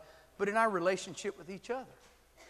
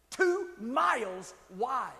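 TWO MILES WIDE, each word drawn out slowly; emphatic repeat; 4 semitones higher, 3 dB louder, much slower than usual. Real, not acted. Preacher stressing the waterfall's size, "Two miles wide" slow and emphatic. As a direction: stretch out "Two", "miles" and "wide" with spaces between.

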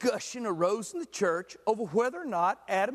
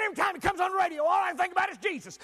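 exasperated outburst; 8 semitones higher, faster than usual. Retold or imitated. Angry man telling the pastor the song is ruined, exasperated. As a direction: blurt it out in frustration.

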